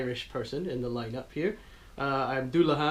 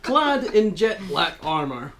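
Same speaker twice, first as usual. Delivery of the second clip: dramatic reading; 7 semitones higher, 7 dB louder than usual. Acted. Dramatic monster intro reading.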